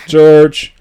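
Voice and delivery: loud, high shout of a name; calling out. Acted. Mom calling out her sons' names.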